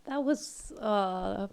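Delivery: shaky, moved voice. Real, not acted. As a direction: voice shaking with emotion. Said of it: "my voice is shaking".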